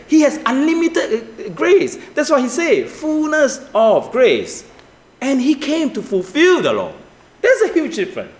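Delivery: high, urgent pulpit voice; impassioned preaching. Real, not acted. Preacher setting Moses against Jesus for the congregation, impassioned preaching. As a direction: drive the contrast home at a high pitch.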